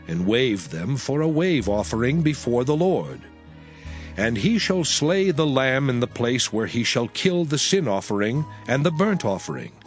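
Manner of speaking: usual speaking voice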